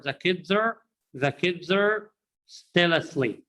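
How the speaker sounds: put-on "kids are" joined as "kidzer"; words as one. Shown as a demonstration. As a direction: repeat "kids are" as a single word, reduced R. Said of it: "words as one word".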